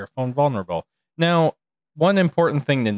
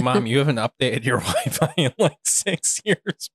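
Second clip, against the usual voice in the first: exasperated; 3 semitones higher than usual. Retold or imitated. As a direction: drag it out in disbelief. Exasperated reply to mom.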